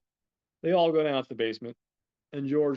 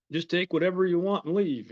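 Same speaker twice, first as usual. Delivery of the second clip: imitated Southern accent, pitched higher; 7 semitones higher, 4 dB louder than usual. Homeowner telling intruders to leave, Southern accent. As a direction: plead lightly in a Southern accent. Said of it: "They've got, like, a Southern accent".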